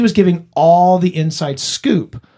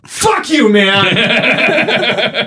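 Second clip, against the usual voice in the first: put-on angry drunk; 6 semitones higher than usual. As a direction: shout it like a belligerent drunk. Drunk friend turning aggressive.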